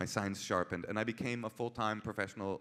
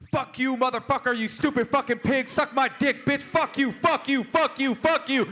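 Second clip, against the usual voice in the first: high, screamed tirade of insults; enraged caller; 9 dB louder than usual. Retold or imitated. Interpreter voicing an angry deaf caller to police, furious scream. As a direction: scream each curse at full force.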